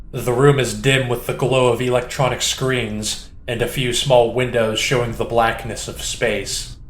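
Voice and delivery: usual speaking voice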